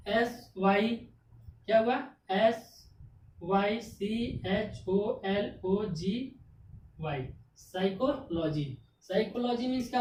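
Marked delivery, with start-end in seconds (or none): 2.3-7.3 slow letter spelling. Shown as a demonstration. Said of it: "teacher spells word letter by letter".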